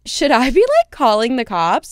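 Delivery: anxious rising question to herself; retelling her own frightened reaction; alarmed. Retold or imitated. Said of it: "It was so scary".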